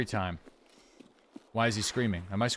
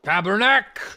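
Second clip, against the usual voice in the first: loud, high mock Quebec swear; shouted Quebec curse; 8 semitones higher, 7 dB louder than usual. Streamer yelling a Quebec curse at chat. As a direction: bellow the church curse for laughs.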